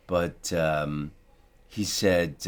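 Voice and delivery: ordinary talk, nothing marked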